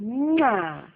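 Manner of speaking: drawn-out "mwah"; blown kiss. Shown as a demonstration. Mother showing toddler how, playful blown kiss. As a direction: make a kissing "mwah".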